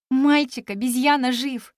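plain reading voice

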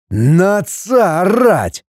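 slow, high-pitched indignation; indignant. A narrator's reading. Father indignant at being yelled at by his son. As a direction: draw it out, scandalised. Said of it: "возмущённо воскликнул Изяслав".

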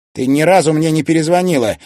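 loud, high-pitched complaint; grumbled reproach. A narrator's reading. Son to his mother on a call, grumbling loudly. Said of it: "буркнул Тимофей".

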